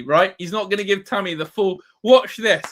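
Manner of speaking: ordinary delivery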